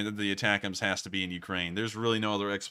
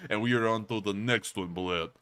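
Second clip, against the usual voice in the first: mock Russian accent. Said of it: "luxurious Russian voice".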